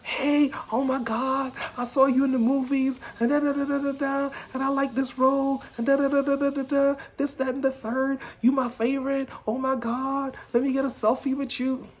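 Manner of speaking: hammed-up excited fan voice; gushing fan. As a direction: squeal it like a starstruck fan meeting a celebrity. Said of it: "Empty-headed people".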